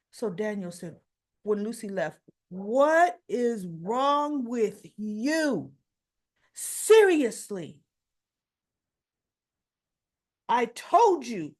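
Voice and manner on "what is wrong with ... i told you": slow, loud, emphatic telling-off; exasperated scolding. Retold or imitated. Daniel confronts Sharon once Lucy has left, exasperated. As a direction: lean hard on each word, acting fed up.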